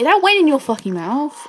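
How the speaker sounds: loud, high-pitched angry protest; indignant outburst. Real, not acted. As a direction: protest loudly, pitch leaping up in outrage. Protests a disallowed shot.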